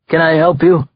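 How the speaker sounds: a gangster pretending to be polite; mock-polite mobster. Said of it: "seemingly polite".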